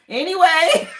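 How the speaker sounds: loud and high through laughter; words breaking up with laughter; laughing. Real, not acted. Reader cracking up at the caller playing dumb, laughing.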